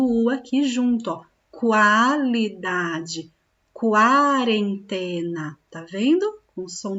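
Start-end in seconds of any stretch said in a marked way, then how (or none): 1.5-5.6 "Qualidade, quarentena" said slowly, the U clearly voiced as "kwa"; QU with U sounded. Shown as a demonstration. Teacher showing the children that the U is heard in QUA. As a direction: linger on the "kwa" at the start of each word. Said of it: "ele fica com som de quá".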